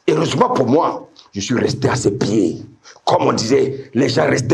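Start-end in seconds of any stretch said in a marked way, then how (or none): none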